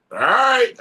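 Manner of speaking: high, pushed-up all right; mock surprise. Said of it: "I'm like".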